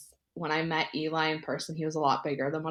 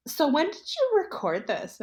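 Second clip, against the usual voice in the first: imitating mom; 9 semitones higher than usual. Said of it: "was like".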